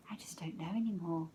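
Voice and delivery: a bare whisper, losing hope; whispered doubt. A narrator's reading. Cam confessing doubt to Alex, whispered. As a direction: breathe it out, nearly gone.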